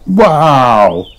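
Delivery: big falling wow of wonder; amazed gasp. A narrator's reading. Pedro watches Kylie leap up, amazed. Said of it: "gasped Pedro".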